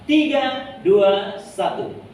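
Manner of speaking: loud, slow countdown to the congregation; leading the church into reading together, loud slow count; countdown call. Real, not acted. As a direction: call the count out big and drawn out. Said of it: "kita baca sama-sama".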